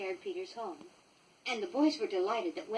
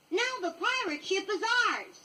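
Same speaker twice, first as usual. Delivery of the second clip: put-on high boyish triumphant voice; a lightly voiced boy crowing over his prize; Pan's triumph; 11 semitones higher than usual. A narrator's reading. Peter Pan claims the pirate ship, high and triumphant. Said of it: "cried Pan".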